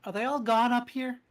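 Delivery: lightly voiced timid boy; boy character. Boy peeks over and asks.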